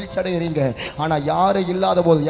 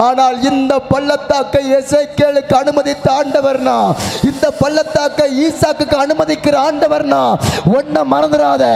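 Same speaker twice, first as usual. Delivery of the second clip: climbing louder and higher to the close; building preaching; 7 semitones higher, 5 dB louder than usual. Acted. Pastor assuring the grieving congregation, rising preaching. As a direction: lift each line toward the promise.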